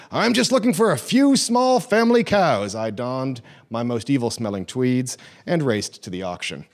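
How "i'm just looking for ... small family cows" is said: loud, much higher, ringing proclamation; trumpeting. Retold or imitated. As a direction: trumpet it out like a grand announcement. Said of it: "Trumpeting the historic phrase".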